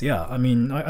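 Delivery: ordinary talk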